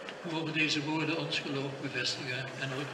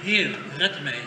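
mildly raised, slow plea; measured scriptural cry, louder and drawn out; retold cry for help; 5 dB louder, slower than usual. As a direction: call out for rescue, read with restraint. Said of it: "begon te zinken en riep".

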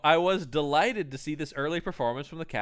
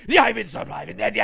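high, loud garbled Cockney threat; hammed-up Guy Ritchie Cockney thug; threatening British gangster; 7 semitones higher, 7 dB louder than usual. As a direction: threaten in mock London gangster gibberish. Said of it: "and he's threatening".

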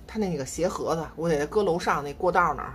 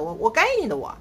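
normal talking voice

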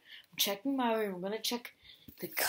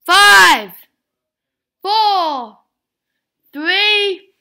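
shouted dramatic countdown; loud countdown; 9 semitones higher, 21 dB louder, much slower than usual. Real, not acted. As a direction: belt out each number.

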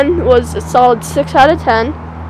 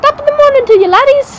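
high, loud Irish greeting; Irish accent; 12 semitones higher, 5 dB louder than usual. Shown as a demonstration. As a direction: call it out high in stage Irish.

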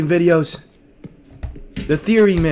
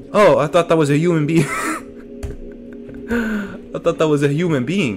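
ordinary delivery

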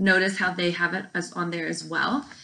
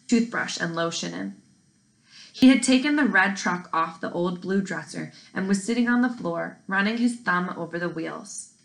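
plain reading voice

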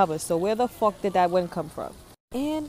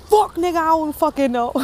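high, loud frightened blurt; scared loud outburst; panicked; 7 semitones higher, 6 dB louder than usual. Real, not acted. Panicked answer to scary question.

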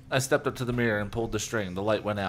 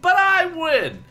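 high, loud, drawn-out gloat; bratty, gleeful sing-out; 9 dB louder, much slower than usual. A narrator's reading. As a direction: crow it slowly with glee. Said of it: "he repeated gleefully".

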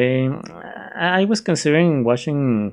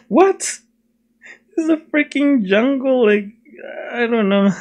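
mock disbelief; 10 semitones higher than usual. Vlogger baffled by the film's setting, mock disbelief. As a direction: blurt it out in comic outrage.

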